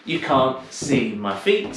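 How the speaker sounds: ordinary talk, nothing marked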